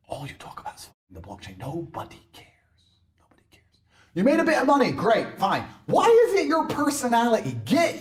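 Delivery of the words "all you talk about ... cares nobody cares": hushed taunt; whispering. Acted. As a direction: whisper it like a secret. Taunting crypto losers.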